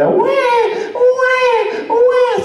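loud, very high imitation baby wail; crying baby. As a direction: bawl like a baby wanting to be held. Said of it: "the baby went".

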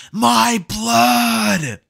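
very high, loud panicked shout; horrified shriek. Acted. Gaara freaking out at his own blood, shrieking. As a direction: scream at the sight of your own blood. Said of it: "he says".

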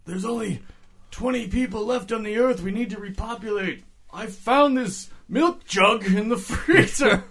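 high, slow frantic doctor. Pretend. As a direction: plead like a mad doctor. Voicing the doctor's plan.

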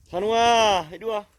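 high, loud coaxing call to a dog. Real, not acted. Owner calling his hiding dog to come out, loud and high. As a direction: coax the hiding dog out from afar.

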